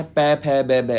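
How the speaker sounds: पै, फै, बै said slowly with both lips pressing; lip sounds demo. Shown as a demonstration. Teacher showing students the lip sounds, each one said deliberately. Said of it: "अब आप बोल के देखिए".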